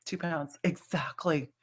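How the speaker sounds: breathy awed whisper. Real, not acted. Echoing guest's weight loss, whispered. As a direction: whisper it like a secret.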